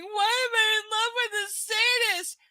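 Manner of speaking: high, whiny mock of the heroine; mocking whine. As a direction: ham up her self-pity.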